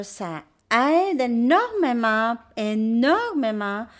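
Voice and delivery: "énormément" drawn out slow and loud; emphatic praise. Real, not acted. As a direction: stretch and lean hard on each "énormément". Emphatic recommendation of the books.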